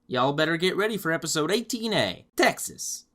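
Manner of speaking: put-on a light Texas accent on the announcement. Reviewer announcing the Texas episode in a mild Texas accent. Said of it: "I'm not gonna do that accent anymore".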